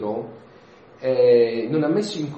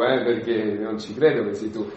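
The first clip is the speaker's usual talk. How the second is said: ordinary talk, nothing marked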